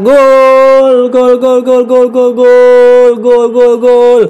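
loud, drawn-out commentator goal yell; goal call. Real, not acted. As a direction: stretch every gol out loud. Scoring goal in football game.

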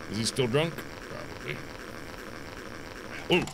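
usual speaking voice

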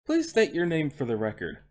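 ordinary delivery, nothing marked

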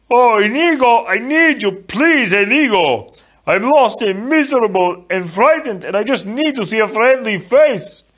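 high, loud, pleading wail; Fezzik, forlorn giant. A narrator's reading. Lost Fezzik calls for Inigo, frightened and pleading. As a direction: wail for your friend like someone lost.

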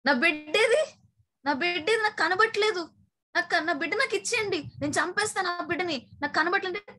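a lightly voiced crazed mother demanding her baby; mock frantic cry. Possessed mother crying out for her baby, high and mildly frantic. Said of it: "aunty ఇలా అరిచింది మళ్ళీ".

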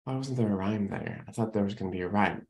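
soft, low, quick inner musing; puzzled wondering. Retold or imitated. Audience member loses track of the missing rhyme, quiet puzzled aside. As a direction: imitate a listener wondering quietly to themselves. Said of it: "the audience begins to wonder".

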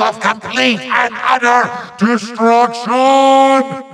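high proclamation, building to a held final word; climactic incantation. Acted. Villain casts ultimate undead spell, climactic high cry. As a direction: build the spell to a big finish.

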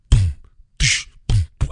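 halting mouth-drum noises; clumsy beatboxing. Shown as a demonstration. Embarrassed host attempting to beatbox, clumsy mouth percussion. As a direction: spit out sparse, awkward beatbox hits. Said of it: "I can't beatbox".

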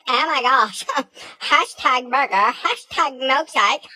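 put-on high, loud gushing girl voice with a giggle, slowing at the end; bubbly girl impression. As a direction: ham up the over-excited waitress. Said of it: "And she's like".

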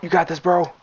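ordinary talk

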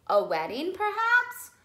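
shop assistant. Acted. Shop assistant voiced in story.

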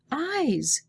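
put-on high frightened cry of a scared girl. A narrator's reading. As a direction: cry it out, pitched high in fear. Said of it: "she cried".

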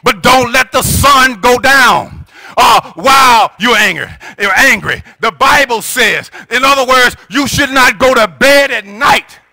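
usual speaking voice

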